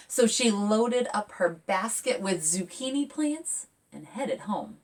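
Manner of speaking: lively picture-book read; reading to children. A narrator's reading. Author reading her picture book aloud to kids, lively. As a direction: read it bright and expressive.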